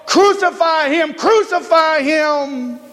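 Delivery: high, insistent mock mob cry; crowd shouting. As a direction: imitate a persistent crowd, pitched high, stretching the last cry. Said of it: "they kept shouting all the more".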